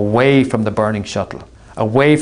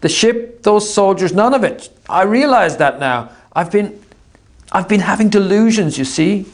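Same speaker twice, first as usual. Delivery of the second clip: Artemis's voice; 10 semitones higher than usual. Acted. Artemis deluded, calling across.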